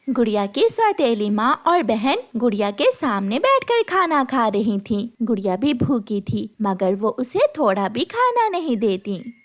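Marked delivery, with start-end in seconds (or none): none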